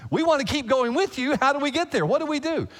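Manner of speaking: an earnest disciple wanting directions; lightly voiced eager Thomas; eager questioner. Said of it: "more like a directional question".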